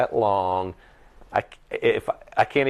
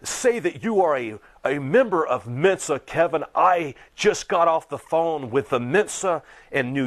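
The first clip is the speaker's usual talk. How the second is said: high indignant mock voice, slowed; lightly voiced outraged rival; a hint of a pompous accuser; mocking quote of Everett; 8 semitones higher than usual. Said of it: "he's like".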